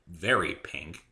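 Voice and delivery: usual speaking voice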